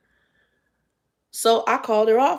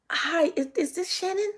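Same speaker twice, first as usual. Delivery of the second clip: mimicking Peach; 10 semitones higher, 4 dB quieter than usual.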